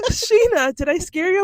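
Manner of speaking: high, loud mock of the boss laughing; teasing boss. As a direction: play the boss, amused at the scare. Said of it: "He goes".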